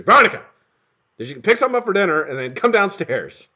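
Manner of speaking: ordinary talk, nothing marked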